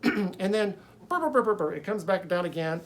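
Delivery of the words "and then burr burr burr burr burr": high, loud burr burr noise like a spring; bouncing sound effect. Retold or imitated. Imitating bouncing serpent sound.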